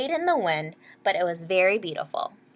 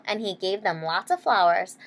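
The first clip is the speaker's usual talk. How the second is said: ordinary delivery, nothing marked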